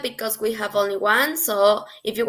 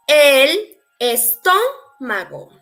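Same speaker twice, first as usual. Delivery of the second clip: "estómago" drawn out, stressed on the TÓ; 3 semitones higher, slower than usual. Shown as a demonstration. Spanish teacher shows where the accent falls, stressing TÓ in "estómago". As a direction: lean on the second syllable of "estómago". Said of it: "this word has the accent".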